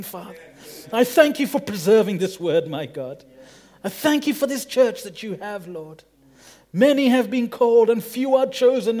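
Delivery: loud, impassioned pleading prayer; fervent prayer. Acted. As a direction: pray out with rising fervour.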